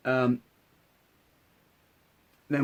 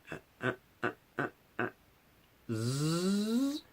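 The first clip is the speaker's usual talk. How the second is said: imitated zip wire noise; 5 semitones higher, 9 dB quieter than usual. To listener, mimicking the puppet cat's jerky glide.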